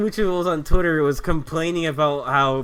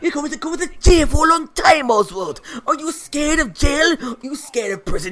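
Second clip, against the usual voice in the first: loud, high, hammed-up threat; threatening lawman; 8 semitones higher, 6 dB louder than usual. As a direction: shout a mock warning at a lawbreaker. Plays threatening lawman scolding Oswald.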